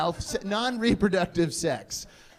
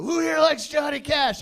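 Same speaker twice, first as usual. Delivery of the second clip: raised, high-pitched question to the ranks; sergeant calling out; 8 semitones higher, 3 dB louder than usual. Retold or imitated. Drill sergeant asks trainees about [name], calling out. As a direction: imitate a sergeant polling the troops.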